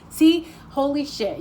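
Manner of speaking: usual speaking voice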